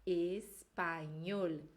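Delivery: espanhol, very slow, in Spanish style; Spanish pronunciation. Shown as a demonstration. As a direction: spell out espanhol slowly as a Spanish speaker would. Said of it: "Em espanhol falamos". (espanhol bem devagar, à espanhola)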